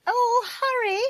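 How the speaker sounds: very high woman's wail; panicky cry. Acted. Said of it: "cried a woman's voice".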